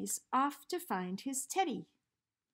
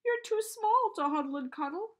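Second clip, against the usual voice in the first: louder, higher crying bear; giant bear's voice; 10 semitones higher, 9 dB louder than usual. A narrator's reading. The bear complains its teddy is too small, loud wailing voice. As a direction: wail at the tiny teddy. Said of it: "it said".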